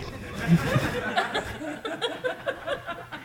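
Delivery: high, soft laugh fading out; gentle fading chuckle; trailing laugh. Real, not acted. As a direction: let the laugh die away.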